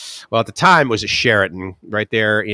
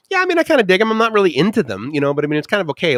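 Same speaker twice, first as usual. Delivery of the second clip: high, hedging reply; wishy-washy answer; 8 semitones higher, much faster than usual. Retold or imitated. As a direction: dither over the railings.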